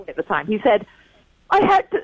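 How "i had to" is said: high imitation of an American teen; American accent. Englishwoman quoting her son's complaint, high put-on American accent. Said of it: "in his American accent".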